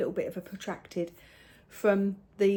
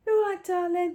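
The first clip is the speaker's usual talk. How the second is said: put-on high, light calling out to a child; sing-song call; 10 semitones higher, 5 dB louder than usual. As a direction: call sweetly into the next room. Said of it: "I thought".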